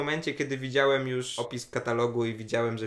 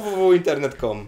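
mock announcer; 3 semitones higher, 9 dB louder than usual. Joke web address.

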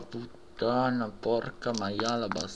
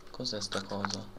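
ordinary talk, nothing marked

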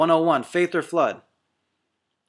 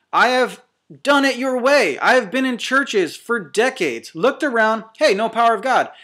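higher, louder, hammering each line; fiery preaching; 3 semitones higher, 4 dB louder than usual. Real, not acted. Preacher challenging offended viewers, fiery full-force preaching.

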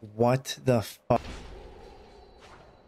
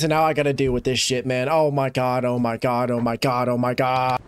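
usual speaking voice

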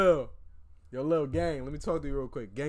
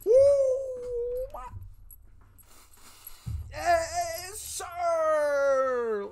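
excited yell; 11 dB louder, much slower than usual. Real, not acted. Hype celebration on stream.

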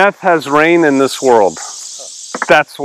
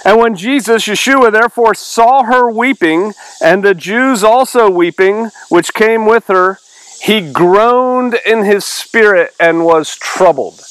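raised, measured Bible-reading voice; higher-pitched, deliberate recitation; scripture reading; 6 semitones higher than usual. Acted. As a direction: read the verse out to a study group. Reads scripture aloud.

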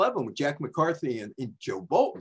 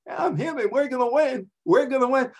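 a hint of a brash quarterback, pitched high; cocky Joe; 12 semitones higher, 5 dB louder than usual. Joe Namath bragging to two starstruck fans, lightly voiced. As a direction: toss off the boast with swagger.

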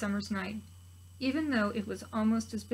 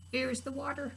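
small raspy voice; 4 semitones higher, 4 dB quieter than usual. Acted. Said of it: "in a small, raspy voice".

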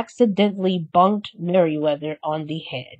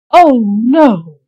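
louder, alarmed exclamation; dismayed cry; 4 semitones higher, 9 dB louder than usual. Acted. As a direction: gasp it out in dismay. Flora sees blue nightgown.

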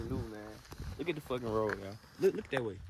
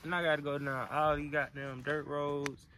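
ordinary delivery

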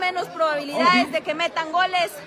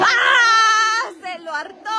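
anguished shout; 6 semitones higher, much slower than usual. Real, not acted. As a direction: wail over the missed chance. Missed chance, cries out.

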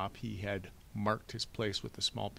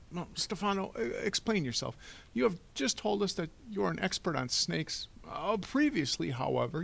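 high-pitched, stammering banker; flustered Mister Poe, demanding; 10 semitones higher, 4 dB louder than usual. Acted. Banker demanding an explanation from Stefano, flustered and fussy.

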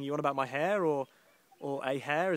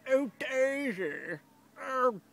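high, long drawn-out mock call; exaggerated high wailing call; imitated calling out; 9 semitones higher, much slower than usual. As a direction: mimic a passer-by calling, stretched slowly. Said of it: "It's sort of like that".

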